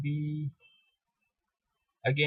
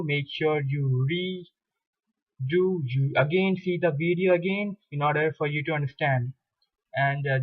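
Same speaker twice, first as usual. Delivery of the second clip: ordinary talk, nothing marked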